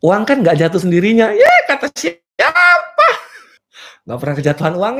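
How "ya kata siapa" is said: high, drawn-out mocking cry; comic high-pitched outburst of disbelief; mocking retort. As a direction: scoff in a squealed, stretched-out voice.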